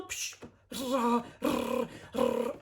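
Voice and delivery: mock whirring spinning wheel sounds; spinning wheel noises. Narrator imitates the imp's spinning wheel, mock whir. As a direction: voice the wheel turning, psh rah rah.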